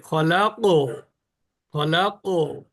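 ফলাক with the ক echoing upward; ফলাক said with a springy ক; qalqala bounce. Shown as a demonstration. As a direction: let ক in ফলাক rebound with a small vowel. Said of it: "এটা হলো কলকলা হলো".

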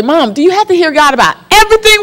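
put-on high, fast whiny child plea; pleading kid. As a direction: beg like an exasperated kid. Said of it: "We would say".

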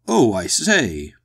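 loud, drawn-out walrus boom; embarrassed boom. A narrator's reading. The walrus greets strangers on the beach, embarrassed boom. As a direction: boom it out slowly, a little embarrassed. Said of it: "his voice was an embarrassed boom".

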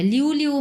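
লিউ with a plain "iu", no o glide; wrong pronunciation. Shown as a demonstration. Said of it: "হবে না".

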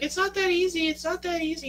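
very high, loud female-lead imitation; pleading movie heroine. Woman answering Noah in The Notebook, high-pitched protest. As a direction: wail it high, mimicking the heroine. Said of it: "and she's like".

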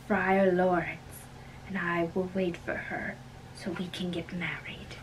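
secret whisper. Acted. Romeo whispering secret plans.

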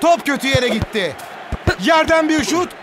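ordinary talk

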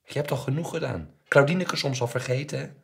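high, very loud reproach; scolding mother. A narrator's reading. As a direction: hurl the reproach as an angry mother. Mother in shouted quarrel. (boze, verwijtende moeder)

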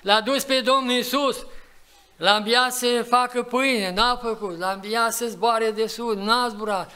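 high, loud preacher's call; fiery preaching. Acted. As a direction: urge the congregation with full force. Preaching climax, raised voice. (predică aprinsă, cu voce ridicată și puternică)

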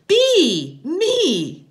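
put-on high, loud child's shout; small girl's piercing cry; loud little girl. A narrator's reading. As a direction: shout it gleefully, drawing attention to yourself. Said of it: "she said loudly".

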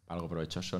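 usual speaking voice

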